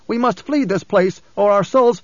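high, rising alarm, cut off; protesting young guide. A narrator's reading. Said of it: "the young guide protested".

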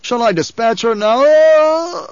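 high, slow shout breaking off; shout, then choke in pain; shouting baron. A narrator's reading.

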